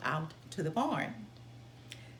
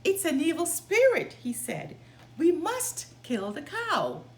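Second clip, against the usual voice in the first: frightened master; 6 semitones higher, 6 dB louder than usual. Acted. Master frightened of evil spirit.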